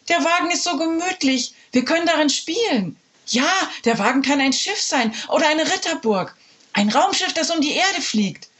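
put-on high, eager kids' voices; eager children. Children defending the trailer, high and eager. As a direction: dream up games excitedly.

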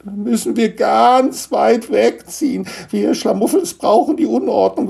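high, tearful lament; crying bitterly. Acted. Gnome must leave his Lego home, crying. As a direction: wail it through hammed-up tears. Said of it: "weinte bitterlich".

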